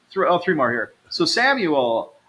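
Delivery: ordinary talk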